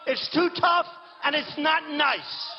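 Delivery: slow, high, loud whining mockery; mocking his critics. Mocking rivals who complain about his tone, slow loud whine. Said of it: "They said".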